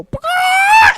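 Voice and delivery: a sudden high, loud "Bah!" squawk; squawking chicken shout. Retold or imitated. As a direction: blast it out like a startled chicken. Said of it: "he'd go".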